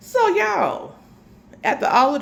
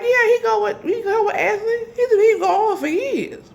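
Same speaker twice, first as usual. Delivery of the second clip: high, crying voice; tearful; 13 semitones higher than usual. Retold or imitated. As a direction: choke out the news through tears. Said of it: "I was real sad".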